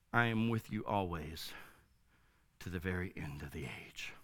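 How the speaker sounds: suddenly soft, low and solemn; quiet, measured close to the verse; hushed scripture quote. Real, not acted. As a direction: drop from preaching into a near hush. Scripture quote drops after loud preaching.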